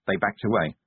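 ordinary delivery, nothing marked

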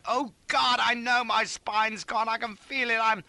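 high, slow wail of agony; high-pitched despairing moan; panicked cry. A narrator's reading. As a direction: wail in terror of being crippled. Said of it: "I cried".

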